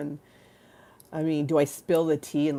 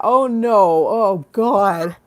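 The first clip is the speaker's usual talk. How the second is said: normal talking voice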